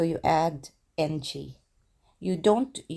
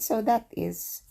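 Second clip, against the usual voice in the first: ordinary talk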